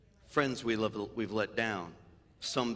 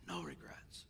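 soft whispered close; a quiet whispered resolve after grief; 12 dB quieter than usual. Real, not acted. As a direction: breathe the words out gently. Closing reflection on mother's death.

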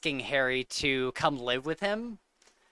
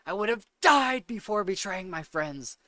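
a higher, lightly voiced Sirius line; intense Sirius; 5 semitones higher than usual. Repeating her favourite Sirius quote, lightly intense. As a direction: quote it with a touch of fury. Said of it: "I love the intensity of".

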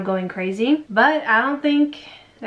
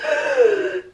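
put-on baby's sharp gasp; 13 semitones higher than usual. Baby comes back to life.

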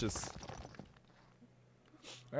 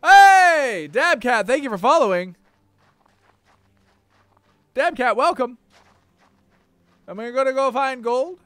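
loud, higher, thrilled shout of thanks; excited greeting; 9 semitones higher, 14 dB louder than usual. Real, not acted. Streamer thanking Dabcat for following, excited. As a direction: shout a big happy welcome.

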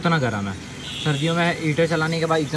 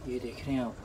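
normal talking voice

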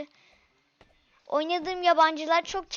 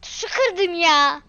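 louder, slow frustrated repeat; 2 semitones higher, 9 dB louder, slower than usual. Real, not acted. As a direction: say it again, louder. Repeats complaint, second louder.

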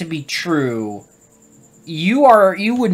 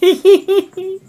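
high-pitched giggle; short burst of high laughter; amused laugh; 3 dB louder than usual. Real, not acted. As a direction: let out a quick, high giggle. Laughs at the game on screen.

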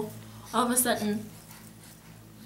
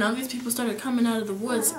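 ordinary talk

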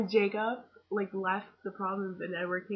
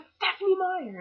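much higher, louder, eager call-out; excited exclamation; 12 semitones higher, 7 dB louder than usual. Retold or imitated. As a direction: point her out to your mom, thrilled. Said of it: "And I was like".

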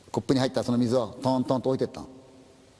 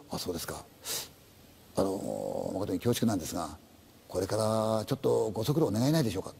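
softer, lower, reluctant officer's voice; hesitant policeman; 6 semitones lower, 5 dB quieter than usual. Acted. Policeman at the dark entrance, soft and hesitant. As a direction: tread carefully, you bring bad news. Policeman asks him to come.

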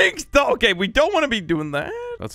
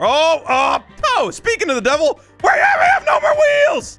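high, loud, half-crying wail; panicked laughing shout; 13 semitones higher, 5 dB louder than usual. Real, not acted. Driver wailing as his car loses all its wheels. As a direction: shriek as the wheels fly off.

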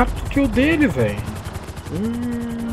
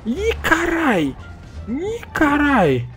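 startled drawn-out cry; 7 semitones higher, much slower than usual. Real, not acted. Sudden shock in a firefight.

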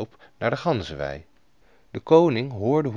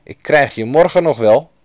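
loud, bitter threat through the teeth; furious threat; 4 semitones higher, 9 dB louder than usual. A narrator's reading. Angry goose boy threatens the princess, loud and furious. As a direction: spit out a promise of revenge. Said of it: "Woedend keek hij naar de prinses".

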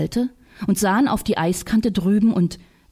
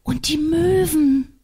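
excited; 8 semitones higher, slower than usual. Acted. Girl points at gulls.

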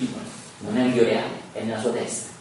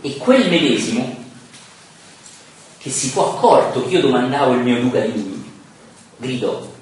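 emphatic, louder verse recitation; lettura di versi; 5 dB louder than usual. Acted. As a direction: declaim the tercet to a class. Reads Dante's verses aloud. (declamazione decisa di versi)

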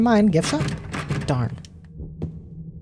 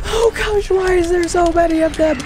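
alarmed; 11 semitones higher than usual. Real, not acted. Panicked at many monsters.

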